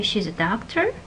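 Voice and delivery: She's a doctor, rising on doctor; questioning lift at the end of doctor; statement said as question. Shown as a demonstration. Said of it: "you can tell if it's a question".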